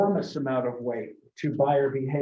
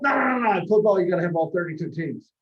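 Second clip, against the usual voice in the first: John Madden voice; 3 semitones higher, 4 dB louder, faster than usual. Retold or imitated. Madden demanding all thirty-two teams from the developers. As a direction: rattle it off like Madden. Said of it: "he kinda talks like this".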